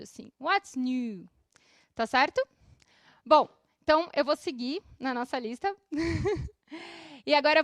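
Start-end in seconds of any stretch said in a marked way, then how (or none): 0.4-1.3 "What's new" with a long oo in "new"; teacher's model "What's new", drawn-out vowel; prolonged U. Shown as a demonstration. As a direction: hold "new" as "noo". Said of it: "como se fosse um U prolongado".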